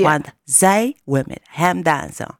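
slow, low mock Tukker accent; imitated Tukker voice. Co-host mimicking TV contestant about dancing, slow Tukker voice. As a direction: drag it out like a put-on Tukker. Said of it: "dat stemmetje nadoen".

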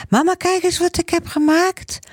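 put-on high little boy's voice, showing mum; excited child. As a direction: run in and show mum like an excited small boy. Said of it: "rent hij naar mama". (hoge, blije kinderstem)

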